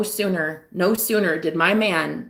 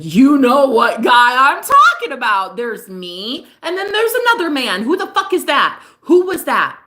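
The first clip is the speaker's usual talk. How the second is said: jealous demand; 5 semitones higher, 7 dB louder than usual. Retold or imitated. Jealous ex demanding who the other man is, rising anger. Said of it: "And he's like".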